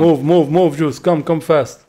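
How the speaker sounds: loud urgent commands; cover me shout. Real, not acted. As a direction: hurry your teammate, loud. Urging teammate under fire.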